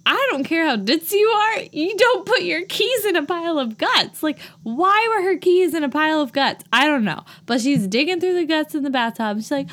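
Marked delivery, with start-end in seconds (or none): 0.1-7.2 exasperated rant. Real, not acted. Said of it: "I became angry about it".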